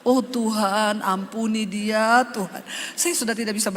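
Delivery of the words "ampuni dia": ordinary delivery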